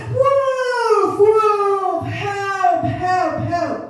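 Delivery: high, long-drawn mock cry for help; acted desperate calls, falling away at the end. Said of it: "So he cried".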